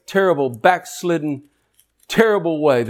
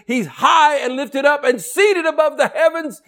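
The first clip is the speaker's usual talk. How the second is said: preaching shout; 9 semitones higher than usual. Real, not acted. Preacher shouting God's majesty to his congregation, full shout. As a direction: proclaim it at full force.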